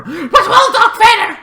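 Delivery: high laughing cry of Darth Vader; giddy high-pitched laughing exclamation; laughing squeal. Real, not acted. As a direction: squeal the name through laughter. Laughing about beating Vader.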